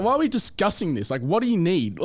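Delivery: conviction. Retold or imitated. Salesman pushing a hesitant prospect to decide, with conviction. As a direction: re-enact an incredulous, certain closer. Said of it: "hear the conviction in my voice".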